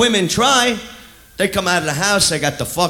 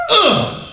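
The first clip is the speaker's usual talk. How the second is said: disgusted groan; 7 semitones higher than usual. Retold or imitated. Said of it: "Here's my impression of a one-night stand".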